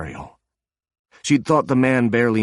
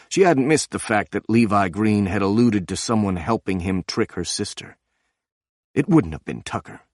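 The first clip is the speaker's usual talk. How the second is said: plain reading voice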